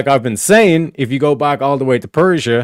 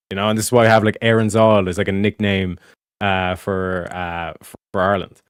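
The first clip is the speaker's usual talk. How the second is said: ordinary talk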